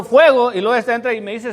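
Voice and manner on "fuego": high loud retold cry; shouting fire. Pastor retelling his shout to the class. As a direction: snap it out as a warning. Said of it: "porque yo grito".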